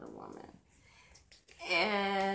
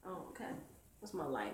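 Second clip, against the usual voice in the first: usual speaking voice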